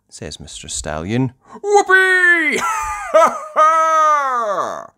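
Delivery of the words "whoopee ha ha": loud, high whoop and laugh; Grampy Rabbit. Acted. Grampy Rabbit speeding along in his hovercraft, gleeful whooping. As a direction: whoop with glee, then a big ha-ha. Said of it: "says Grampy Rabbit".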